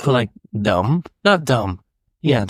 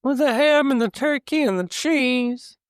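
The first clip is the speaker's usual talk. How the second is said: much higher twangy unsure country voice; Shaggy voice; 12 semitones higher than usual. Pretend. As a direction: whine it like a clueless stoner.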